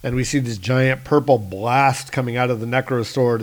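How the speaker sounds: usual speaking voice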